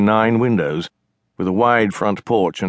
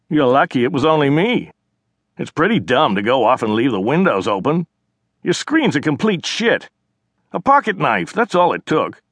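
cocky brother; 6 semitones higher than usual. Acted. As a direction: taunt her like a smug kid brother. Narrator voices the brother.

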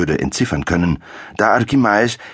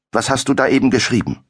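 higher, quick demand; pressing count; 7 semitones higher, faster than usual. A narrator's reading. The count presses the alchemist about his writing, quick and demanding. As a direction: push for an answer.

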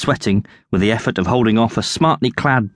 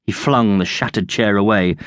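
plain reading voice

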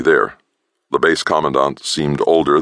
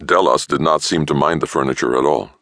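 plain reading voice

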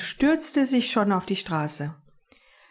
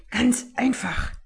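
a sharp, breathless whisper; secretive whisper to his friend; hissed whisper; 4 semitones higher than usual. Acted. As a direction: lean in close and hiss it. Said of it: "zischte er".

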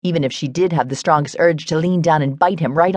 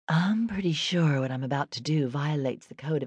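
soft, breathless whisper; 11 dB quieter than usual. A narrator's reading. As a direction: whisper it close to his ear. Confiding in him, whispered.

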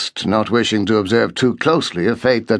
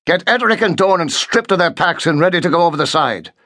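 brisk, raised command; commanding officer; 7 semitones higher than usual. A narrator's reading. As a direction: order it briskly. Officer giving urgent orders.

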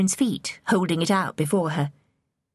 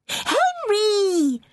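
put-on very high, drawn-out girlish call; eager princess hailing her brother at length; long excited call; 3 dB louder than usual. A narrator's reading. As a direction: stretch the name out to carry below. Mary calls again, drawn out.

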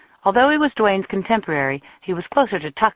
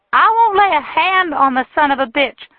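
shrill, much higher furious shout; furious woman; 10 semitones higher, 7 dB louder than usual. A narrator's reading. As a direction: hurl it at him, loud and high. Jilted woman rages at Tucker.

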